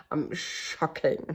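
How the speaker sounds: stunned whisper. Real, not acted. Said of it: "choquée".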